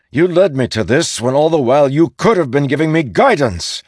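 loud, high frustrated accusation. A narrator's reading. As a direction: throw the blame at him.

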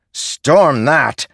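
loud blunt retort; gruff shout. Acted. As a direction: bark it back at him. Soldier refuses bluntly.

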